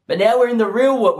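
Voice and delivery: ordinary talk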